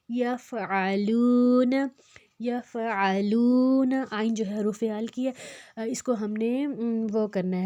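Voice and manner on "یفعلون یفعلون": slow یفعلون with ain from the throat, long "loo"; throaty ain. Shown as a demonstration. Teacher models a throat letter, throaty ain in یفعلون. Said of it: "حلق سے نکالنا ہے".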